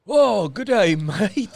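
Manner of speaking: high, loud mock Australian accent; bad Australian accent. Son attempting a French one, bad Australian accent. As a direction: imitate a kid's over-the-top Australian accent. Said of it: "like, an Australian accent".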